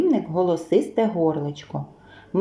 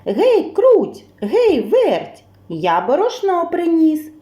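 високий, гучний оклик; гукни друзів і похвались новиною; кличе мишенят; 7 semitones higher, 4 dB louder, slower than usual. A narrator's reading. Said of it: "знов мишенят кличе". (півник гукає мишенят)

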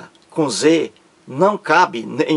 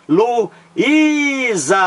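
Luísa with a long, high Í; Luísa, middle Í stretched out high; stressed syllable demo; 7 semitones higher than usual. Shown as a demonstration. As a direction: hold the second syllable of Luísa, lu-ÍÍÍ-sa. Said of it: "a sílaba mais forte aqui é a segunda".

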